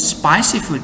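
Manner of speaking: usual speaking voice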